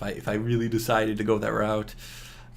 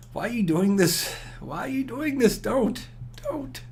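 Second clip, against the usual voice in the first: mock pleading; 11 semitones higher than usual. Gamer begging himself not to attack, mock pleading. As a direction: pitch climbing higher with each plea.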